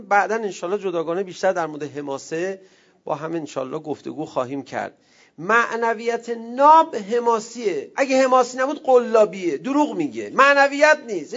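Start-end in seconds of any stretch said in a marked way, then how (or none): 5.4-11.3 louder, higher preaching voice building up; forceful preaching. Acted. Preacher rousing the congregation, louder and higher. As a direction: drive the point home to the crowd. Closing sermon climax.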